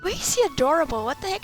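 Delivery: ordinary talk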